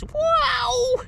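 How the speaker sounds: very high, loud amazed cry; amazed wow. Real, not acted. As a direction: yelp it out in amazement. Exclaiming at big fish.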